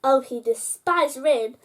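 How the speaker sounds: plain reading voice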